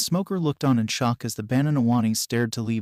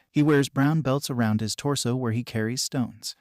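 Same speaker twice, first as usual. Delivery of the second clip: plain reading voice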